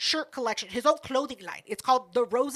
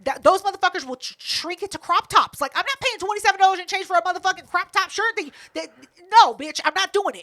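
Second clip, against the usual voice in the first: high, loud refusal to pay; indignant rant; 6 semitones higher, 6 dB louder than usual. Retold or imitated. Shopper refusing to pay for a shrinking shirt, indignant. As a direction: rant it out, raised and outraged.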